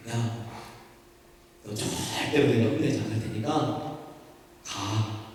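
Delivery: soft, gentle, smiling father's voice; smiling reassurance. Retold or imitated. Father reassuring his little daughter he won't scold her, softly smiling. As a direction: reassure her kindly with a smile. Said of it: "제가 아주 웃는 얼굴로".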